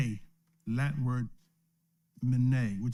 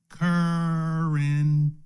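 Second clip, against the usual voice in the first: currency drawn out very slowly; 4 dB louder, much slower than usual. Shown as a demonstration. Teacher modelling currency slowly for the class. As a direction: stretch every sound of currency. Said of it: "Say it slowly".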